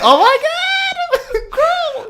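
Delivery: slow, very high squeal with a laugh; excited squeal. Retold or imitated. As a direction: shriek it like a fangirl. Reacting to favourite scene.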